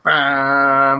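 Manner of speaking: drawn-out, booming musical "bam"; imitated theme blast. Telling co-hosts how Godzilla's theme comes in, imitated blast. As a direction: mimic a movie theme's opening hit. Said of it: "you hear his theme go".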